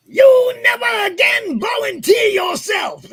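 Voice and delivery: high, loud and slow; drill instructor shout. Retold or imitated. Drill instructor bellowing his rule at the recruits, slow and loud. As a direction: bellow each word slowly like a drill instructor.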